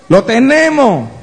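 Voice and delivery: loud, slow, ringing declaration; emphatic preaching. Real, not acted. Preacher proclaiming to the church, loud and slow. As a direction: hammer it home to the congregation. Preacher drives home his point.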